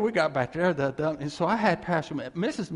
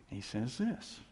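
normal talking voice

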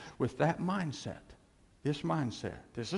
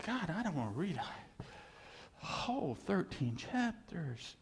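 slow reluctant whine, a bit higher; whiny reluctant complaint; 4 semitones higher, 5 dB quieter, slower than usual. Retold or imitated. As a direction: grumble like a kid who won't read. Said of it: "I said".

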